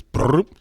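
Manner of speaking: cartoon fart sound effect; mock fart noise. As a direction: blurt out a silly fart noise. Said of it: "equivalent to farting in the bathtub".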